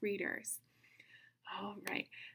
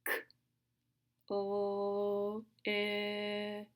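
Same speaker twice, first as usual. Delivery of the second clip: super slow sounds; much slower than usual. Shown as a demonstration. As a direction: separate sounds, vowels held long. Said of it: "Listen one more time".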